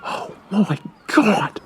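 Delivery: slow, hushed hiss. Acted. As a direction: hiss it so the guards can't hear. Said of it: "hissed Maud".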